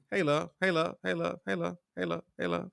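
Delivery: "Hey, love." rattled off softly, on repeat; rapid copy-paste replies. Retold or imitated. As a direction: fire off the same "Hey, love." over and over. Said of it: "go down the whole fucking list and say".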